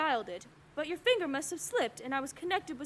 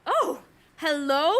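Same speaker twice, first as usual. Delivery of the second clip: startled; 8 dB louder, slower than usual. Acted. Cut-in voice on crossed line.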